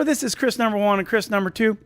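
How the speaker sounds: ordinary delivery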